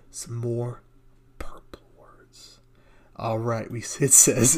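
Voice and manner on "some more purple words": breathy whisper aside, much softer; hushed mock aside. Real, not acted. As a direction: drop to a secret whisper for the joke. Joking aside on fancy words.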